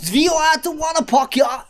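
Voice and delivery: high, shouted mock German accent; camp German accent.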